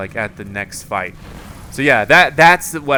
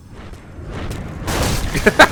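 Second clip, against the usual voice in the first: breathy high burst of laughter; 9 semitones higher than usual. Real, not acted. Player laughing at dying again. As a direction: laugh at your own blunder.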